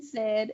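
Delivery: "sad" with her lisping s; lisped word. Shown as a demonstration. Guest showing which words reveal her lisp. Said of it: "when you say things like".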